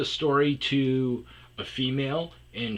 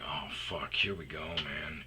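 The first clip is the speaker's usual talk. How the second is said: whispered curse; 7 semitones lower, 9 dB quieter than usual. Retold or imitated. Muttering as the man approaches.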